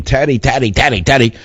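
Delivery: ordinary talk, nothing marked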